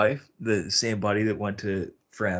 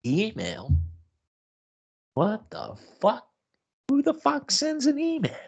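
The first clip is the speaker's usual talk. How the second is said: rising higher and higher in outrage; disbelief. Retold or imitated. Reacting to her email demand, higher incredulous climbing outburst. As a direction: squeak out the absurdity, climbing. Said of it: "And I'm like".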